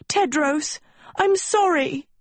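very high, desperate apology; distressed high-voiced wail; crying out. A narrator's reading. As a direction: cry it out in a high pitch. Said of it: "Sophie cried".

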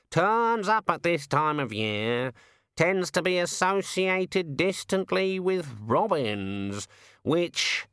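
a put-upon bird having a moan, slowly; raven voice. Acted. The raven explains.

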